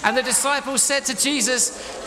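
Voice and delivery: high, loud, dramatic narration; urgent storytelling. A narrator's reading. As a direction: build the storm at full volume.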